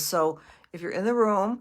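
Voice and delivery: ordinary delivery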